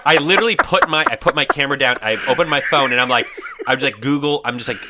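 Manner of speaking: high, loud, laughing retelling. Real, not acted. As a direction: laugh through the embarrassing admission.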